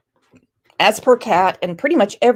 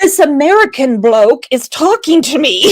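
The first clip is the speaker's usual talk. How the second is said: mock British accent; 4 semitones higher, 6 dB louder than usual. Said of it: "a message in the Queen's English".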